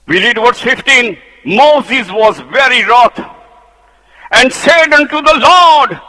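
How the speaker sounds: high, loud pulpit fervour; preaching at full force. Acted. Preacher rousing the church against the rebels, fervently. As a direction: build the fire in the sermon.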